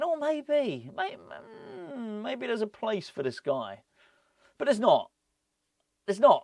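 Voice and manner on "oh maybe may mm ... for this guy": hopeful musing. Retold or imitated. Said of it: "and thinking".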